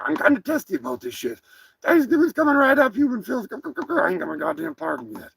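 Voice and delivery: a cocky cartoon turkey boasting; turkey character.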